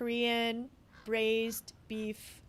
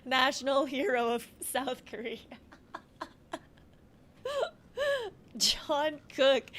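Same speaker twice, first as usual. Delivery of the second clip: laughing through the words; can barely get it out for giggling; 2 semitones higher, 4 dB louder, slower than usual. Real, not acted. Cracking up about the John Cook mispronunciation, laughing. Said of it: "These are so funny".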